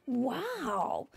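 breathless, whispered amazement; amazed whisper. Acted. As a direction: whisper it in awe. Chase amazed at party.

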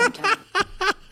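shrill falsetto mock giggle; high mocking laugh. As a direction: cackle high in a mock woman's voice. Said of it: "she sounds like it".

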